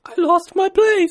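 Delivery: slow, high falsetto aside; man's falsetto British lady. Acted. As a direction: stay in the put-on lady voice while lost. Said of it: "How's your lady voice, [name]?".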